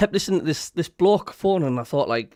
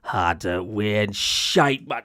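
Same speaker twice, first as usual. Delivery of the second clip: low, drawn-out scornful impression; imitating a man scoffing slowly; Big Davey; 7 semitones lower, much slower than usual. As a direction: drag it out slowly in a low voice, dismissive. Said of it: "He went, uh".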